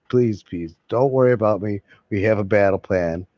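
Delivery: usual speaking voice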